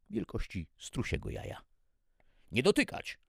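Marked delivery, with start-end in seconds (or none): 2.5-3.2 high, loud command; sharp order. A narrator's reading. Security officer stopping the cook from touching the container, loud order. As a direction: order it urgently, much louder. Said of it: "zarządził oficer ochronny".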